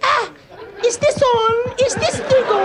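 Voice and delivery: very high squeaky mic check; piercing mock shriek. Screaming in the night into a mic, piercing mock shriek. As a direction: squeal it far above your own voice.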